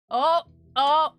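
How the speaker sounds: high, loud laughing oh oh; squealing with laughter; laughing cry. Real, not acted. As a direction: burst out laughing at the cat. Cat in awkward pose.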